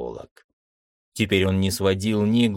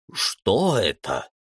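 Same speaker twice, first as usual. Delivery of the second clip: frightened whisper; 3 semitones higher, slower than usual. A narrator's reading. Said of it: "испуганно зашептал зоолог".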